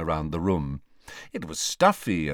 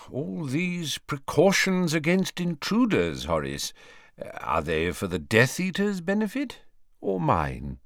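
put-on measured, probing old wizard; lightly voiced Dumbledore; 7 semitones higher than usual. Headmaster questions old colleague about his precautions, lightly probing. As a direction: probe gently, lifting then dropping the voice.

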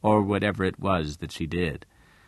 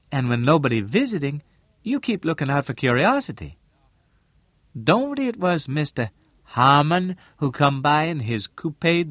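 higher-pitched Southern dialect voice, probing; shrewd businesswoman character; 7 semitones higher, 4 dB louder than usual. Acted. Missus Lane asking Al about his visitor, probing. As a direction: voice an inquisitive, shrewd woman in dialect.

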